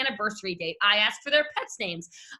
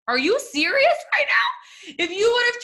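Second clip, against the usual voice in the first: are you kidding me, nearly crying; incredulous shout; 9 semitones higher, 4 dB louder than usual. Real, not acted. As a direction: let it burst out, horrified. Incredulous outburst at numbers.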